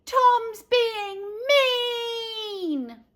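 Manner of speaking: put-on high, slow, crying little-girl wail; high, stretched crybaby whine; whiny yell. A narrator's reading. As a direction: wail for mum like a fake-crying toddler. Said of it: "Then I yelled".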